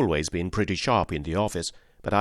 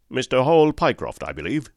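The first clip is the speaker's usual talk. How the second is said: brisk sharp speaker; 5 semitones higher, 5 dB louder than usual. A narrator's reading. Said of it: "spoke sharply".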